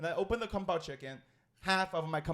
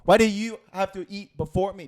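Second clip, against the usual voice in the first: loud, heated demand; angry; 2 semitones higher, 13 dB louder than usual. Retold or imitated. Retelling how he yelled at his coworker, angry and loud. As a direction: confront the coworker in a furious voice. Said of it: "I was already angry".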